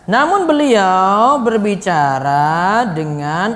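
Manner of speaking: slow, low, every word distinct; clear measured speech. Shown as a demonstration. Teacher showing how the Prophet spoke plainly, slow clear delivery. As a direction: draw each word out clearly.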